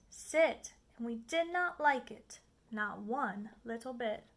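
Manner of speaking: ordinary delivery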